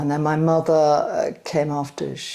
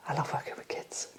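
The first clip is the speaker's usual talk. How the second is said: soft whisper; 15 dB quieter, faster than usual. Real, not acted. To the interviewer, a fond whispered aside about loving kids. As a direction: breathe the words out in a whisper. Repeating that she loves working with kids.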